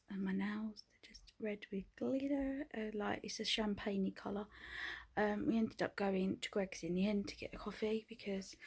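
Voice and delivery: whispered vlog update; whispering. Real, not acted. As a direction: whisper so no one wakes.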